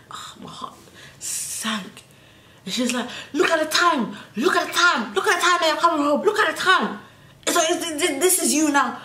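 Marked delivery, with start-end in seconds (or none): 0.1-2.0 breathy whisper, heart sinking; whispered dread. Retold or imitated. Teen whispering dread as mum confronts her. 3.3-7.0 high scolding mum, laughing through it; mocking mum voice. Daughter imitating her mum scolding her for coming home late. 7.7-9.0 mum voice. Retold or imitated.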